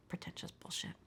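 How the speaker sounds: dropped to a whispered aside; hushed, whispered punchline; conspiratorial whisper. Real, not acted. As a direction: whisper it, barely voiced.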